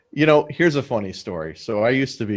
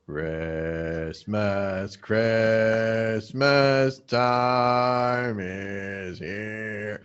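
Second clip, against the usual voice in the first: slowed-down record voice; 2 semitones lower, 5 dB quieter, much slower than usual. Shown as a demonstration. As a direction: sing it like a record at the wrong speed. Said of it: "We played it at thirty-three and a third".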